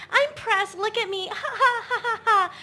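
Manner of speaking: put-on high, bubbly Valley Girl with giggles; airy ditzy Valley Girl, laughing. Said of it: "it's my Valley Girl voice".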